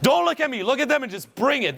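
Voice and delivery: high, urgent rallying calls; urgent coaching. Real, not acted. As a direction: egg them on in short high bursts. Coach urging speaker to let loose.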